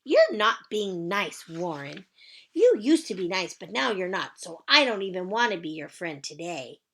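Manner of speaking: put-on huffy, loud little-girl pout. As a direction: scold him like an offended playmate. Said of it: "Lucille did a huffy breath at him".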